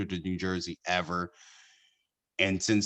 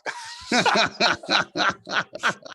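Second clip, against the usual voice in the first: high burst of real laughter; big laugh; 14 semitones higher, 3 dB louder than usual. Real, not acted. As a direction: crack up at your own story. Cracking up at own story.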